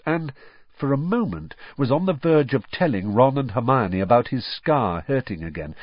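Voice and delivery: ordinary talk, nothing marked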